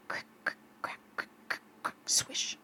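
voiceless, soft crick-crack sounds; imitated crackling noises. A narrator's reading. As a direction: click out small night noises, very softly. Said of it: "when he heard".

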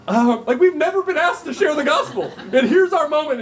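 high, loud, laughing through it; laughing disbelief. Real, not acted. Preacher recalling being asked the gospel, laughing loudly. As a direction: laugh at the memory. Retelling panicked moment, laughing.

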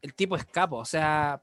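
ordinary talk, nothing marked

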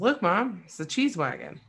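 put-on high, loud, eager little kid; a toddler proudly showing off his new word; excited toddler. Toddler proudly calling out to mom from the back seat, excited and high. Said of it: "Desmond says".

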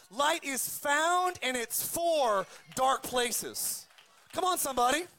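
usual speaking voice

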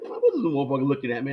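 quick annoyed inner voice, rushed; fast muttered thought. Retold or imitated. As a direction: rattle it off, suspicious of the stranger. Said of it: "I was like".

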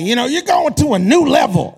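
imitated pulpit hype; hyped-up pastor. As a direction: announce it like a rousing promise. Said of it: "some pastor say this one".

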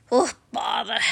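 a grumbly little huff of a bear; exasperated Pooh. Acted. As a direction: sigh it out, put upon. Pooh's grumble.

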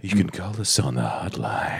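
low, soft sultry hotline voice; breathy come-on for the hotline; mock seductive. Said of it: "Sexy voice on the, on the hotline".

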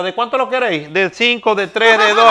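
joke character. Acted. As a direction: build the punchline up. Joke punchline voice.

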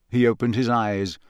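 ordinary talk, nothing marked

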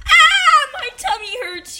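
loud, high cry easing into complaint; wailing. A narrator's reading. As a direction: wail in hammed-up bellyache. Samuel wails at sight of pies.

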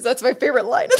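ordinary talk

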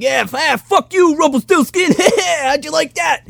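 high, gleeful taunting jeer, up to a yell on fuck you; mocking villain taunt. Villain jeering at Rumpelstiltskin, high gleeful taunt. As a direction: crow over the fallen enemy. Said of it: "and he's like".

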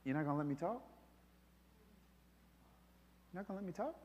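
soft, very quiet and tender; tender, gentle question. Retold or imitated. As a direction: ask it gently, like a hurt friend. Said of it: "so tenderly was just like".

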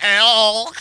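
loud mock elk call; elk bugle. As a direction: belt it out like a bull elk. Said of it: "That's the first time anybody said the word".

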